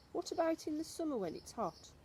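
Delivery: put-on high, questioning young boy; boy's voice. Boy challenges grandmother, high and puzzled. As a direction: pitch it up like a puzzled child.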